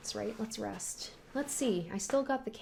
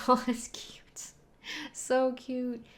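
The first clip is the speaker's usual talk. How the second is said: cooing over cute scene; 5 semitones higher, much slower than usual. Real, not acted.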